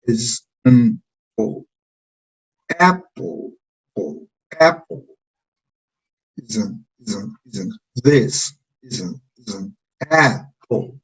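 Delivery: is an said slow and very soft, mumbled, before apple; reduced weak words. Shown as a demonstration. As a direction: swallow is an, then land on apple. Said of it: "van a tener una sonoridad muy baja".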